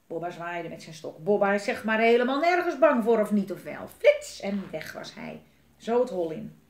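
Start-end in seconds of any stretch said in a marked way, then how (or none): 1.2-3.9 loud, rising boast; cocky little show-off; boastful bravado. A narrator's reading. Bobba boasts he fears nothing. 3.9-4.4 very high, loud, quick zip; exclaimed sound effect. A narrator's reading. Sound word for darting away.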